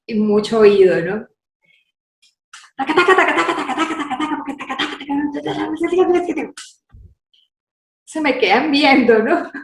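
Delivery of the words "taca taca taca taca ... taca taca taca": rapid nonsense syllables imitating dubbed dialogue; mimicked lip sync. Shown as a demonstration. Actress showing how she dubbed the scene, rapid babble. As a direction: rattle off taca taca like dubbing lines fast. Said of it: "Veo la broma y tal".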